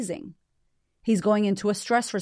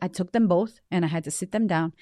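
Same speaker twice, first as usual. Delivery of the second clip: ordinary delivery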